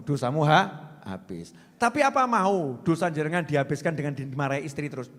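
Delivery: ordinary talk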